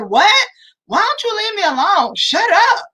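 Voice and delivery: high, mock-bratty kid talking back; sassy child. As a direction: snap back like a rude kid. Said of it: "And the child said".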